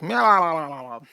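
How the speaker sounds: echoing meow. Shown as a demonstration. Reading a donation, echoing cat meow. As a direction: meow that trails off in echoes, meow-lalal. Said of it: "but, like, in echoes".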